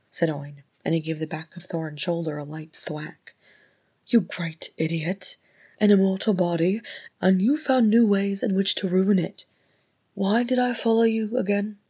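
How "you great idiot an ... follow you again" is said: loud, higher, heated rebuke; indignant outburst, climbing in pitch; exasperated scolding. A narrator's reading. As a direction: berate him with raised voice. Healer berates his king.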